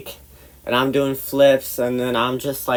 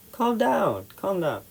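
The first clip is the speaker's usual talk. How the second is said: hushed placating; 7 semitones higher, 6 dB quieter than usual. Retold or imitated. Retold soothing in quarrel.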